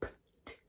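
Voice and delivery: whispered P and T, only air; voiceless P and T. Shown as a demonstration. Teacher contrasting airy sounds with voiced ones. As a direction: puff out P and T without voice. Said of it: "it's more than air".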